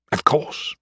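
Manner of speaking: small unvoiced, uneasy reply; nervous. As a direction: answer guardedly, barely voicing it. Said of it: "Justice answered nervously".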